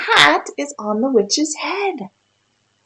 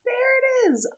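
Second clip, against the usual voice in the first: excited; 13 semitones higher than usual. Real, not acted. Child finds the picture.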